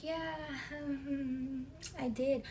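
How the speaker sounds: high, halting, reluctant admission; retold awkward, wary agreement; uneasy hesitation. Retold uneasy reply to the stranger.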